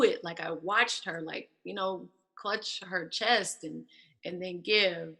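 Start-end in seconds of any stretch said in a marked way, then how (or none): none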